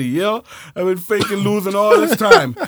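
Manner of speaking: loud, high hammed-up villain reveal; mock villain. The friend revealing he faked losing, gloating loudly.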